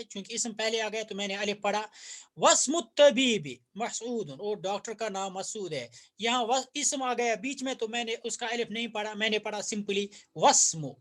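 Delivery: ordinary delivery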